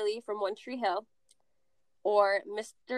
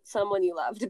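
ordinary talk

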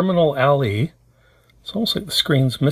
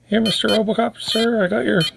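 eager helper voice; 10 semitones higher than usual. Pretend. Voicing a helper handing over gun.

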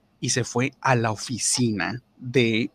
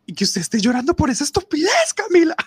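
much higher and louder reproach; exasperated scolding; 7 dB louder than usual. Retold or imitated. Manager scolding Camila for crying over a prank, loud. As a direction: scold her like a fed-up boss.

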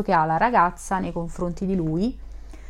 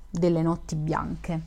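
plain reading voice

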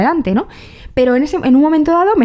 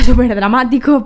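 laughing; 4 dB louder than usual. Real, not acted. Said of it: "perdón".

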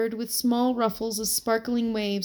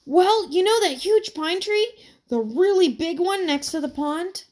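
put-on eager boy voice; 8 semitones higher, 4 dB louder than usual. A narrator's reading.

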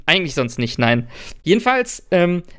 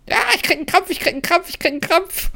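high, whiny mock complaint; 14 semitones higher, faster than usual. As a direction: squeal it like a drama queen. Mocking the cramp complaint.

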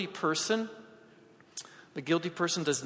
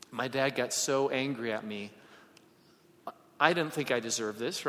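ordinary talk

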